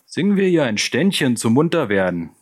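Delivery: rally the gang for some mischief; jeering rallying call. Acted. Ringleader rouses his gang to serenade the victim, jeering.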